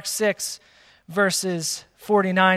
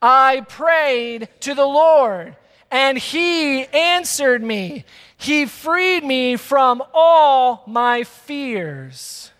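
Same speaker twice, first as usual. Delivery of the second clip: very slow, high, loud congregational recitation; reading out loud together; 5 semitones higher, 4 dB louder, much slower than usual. Real, not acted. Preacher leads congregation reading a psalm, slow loud recitation. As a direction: lead the room, stretching each word. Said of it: "Say this with me out loud".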